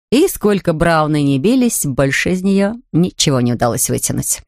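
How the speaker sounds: usual speaking voice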